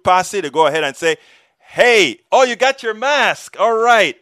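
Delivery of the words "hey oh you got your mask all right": high, loud cheerful hey; excited greeting. Pretend. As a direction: greet a friend with delight. Imagined posse greeting.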